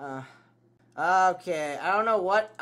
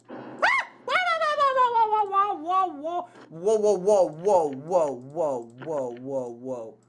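falling whoa; slower than usual. Real, not acted. Long descending whoa.

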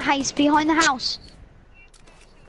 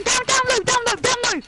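urgent shout; 8 semitones higher than usual. Real, not acted. Urgent callout mid-fight.

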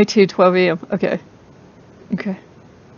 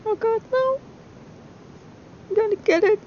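frightened whimper; 13 semitones higher, 8 dB quieter than usual. Real, not acted. Cornered in horror game.

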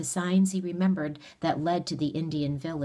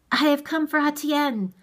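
high, loud announcement; raised, higher-pitched demanding call; making himself heard; 11 semitones higher, 7 dB louder than usual. A narrator's reading. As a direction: call it out over the noise. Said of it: "when he could make himself heard".

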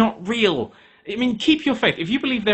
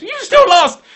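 much higher and louder, outraged; exasperated yell; 9 dB louder, slower than usual. Acted. Apprentice host shouts at God over the lost task, outraged yell. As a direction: explode at the excuse.

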